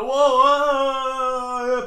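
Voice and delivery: long, high, loud mock cry; alarmed hollering. People answering the guy in the water, alarmed holler.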